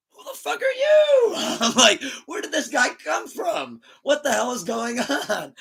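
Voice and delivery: very high, stretched squeal of disbelief; hammed-up high-pitched shock; mock disbelief. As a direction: act out being utterly baffled by a friend. Acting out shock at friend.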